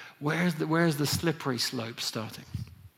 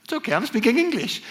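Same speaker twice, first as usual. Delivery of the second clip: daughter's reply; 7 semitones higher than usual. Retold or imitated. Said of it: "She's like".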